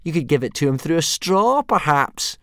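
high, loud mockery; bully taunting Hiccup's friends. A narrator's reading. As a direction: rub it in with fake concern. Snotlout taunting.

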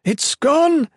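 high, loud cry of alarm; panicked exclamation. A narrator's reading. Nolan seeing everything lost, high panicked exclamation. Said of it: "Nolan exclaimed".

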